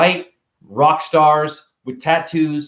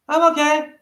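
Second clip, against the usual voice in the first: put-on higher little kid voice; 12 semitones higher than usual. A kid answering a stranger, higher small voice. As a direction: answer like a shy ten-year-old.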